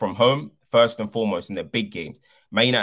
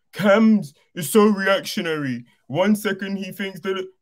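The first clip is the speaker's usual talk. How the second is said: high, loud mimicked complaint; mocking commenters; 10 semitones higher, 5 dB louder than usual. Fan imitating commenters who got on to him, mocking high voice. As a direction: whine it out like an aggrieved commenter. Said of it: "They were getting on to me".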